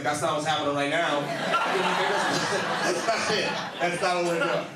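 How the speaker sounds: high, loud, cracking up through the words; laughing disbelief. Retold or imitated. Telling drunk Lucas what is really happening, laughing loud. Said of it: "And I was like".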